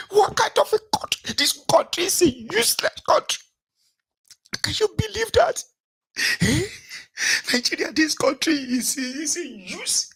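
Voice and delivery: high, exasperated complaining voice, well above his own; mock outraged Nigerian. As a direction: mimic a fed-up Nigerian ranting about the country. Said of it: "you go to hear".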